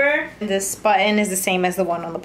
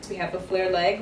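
ordinary delivery

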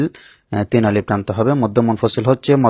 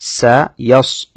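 slow, drawn-out melodic tajweed recitation; slow sung Quranic tilawa; 3 semitones higher, 5 dB louder, much slower than usual. Shown as a demonstration. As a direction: chant each word long and measured. Recites the verses.

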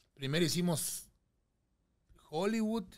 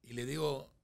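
usual speaking voice

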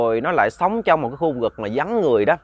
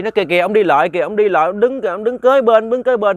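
put-on much higher, louder frantic child; urgent child pointing; 7 semitones higher, 6 dB louder than usual. A narrator's reading. Grandchild frantically pointing out the giant beside them, high and urgent. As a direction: insist he is right there.

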